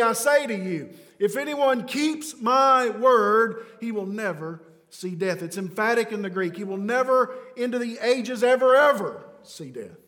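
loud, high, fervent sermon delivery; preaching at full force. Acted. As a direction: press every line on the crowd like eternity hangs on it.

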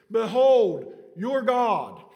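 loud, high ringing proclamation, falling on each phrase; thundering biblical declaration; proclaiming. Acted. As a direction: herald it to the crowd. Proclaiming Behold, building.

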